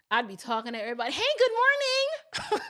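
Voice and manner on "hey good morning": high, bright mock hello to strangers; cheery Southern greeting. Greeting everybody on the street, high and cheery. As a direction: sing out a friendly good morning.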